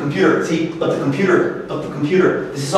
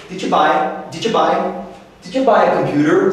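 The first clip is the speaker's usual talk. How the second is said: "Did you" run together, weight on "buy"; natural reduced stress. Shown as a demonstration. Teacher drills what we say, natural connected stress. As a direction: squeeze "Did you" into one quick unit before "buy". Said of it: "We say".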